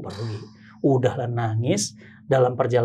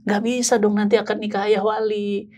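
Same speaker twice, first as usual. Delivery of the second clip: higher-pitched mock plea; dismayed retold protest; 6 semitones higher than usual. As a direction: re-enact a worried objection, pitched up. Menirukan keberatannya soal wali nikah. (menirukan protes cemas bernada tinggi)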